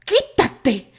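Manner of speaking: loud shove-away command; Isaac pushes Terry aside, loud and angry. A narrator's reading.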